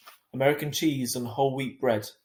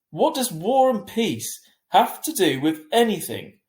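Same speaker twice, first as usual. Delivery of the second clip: slow, loud, high annoyed retort; 8 semitones higher, 8 dB louder than usual. A narrator's reading. Mum snapping at Dad, slow and loud. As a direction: bite back, clearly annoyed. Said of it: "clearly annoyed".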